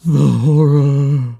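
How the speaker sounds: swelling menacing echo. A narrator's reading. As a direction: swell it slowly into an ominous cry. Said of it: "seemed to swell menacingly".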